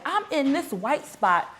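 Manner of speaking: high, rising mock tirade; exasperated scolding. Embarrassed scolding, retold.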